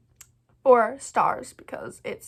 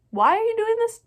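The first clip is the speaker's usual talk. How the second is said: mock outrage; 12 semitones higher, 6 dB louder than usual. Real, not acted. Protesting the author's plot twist.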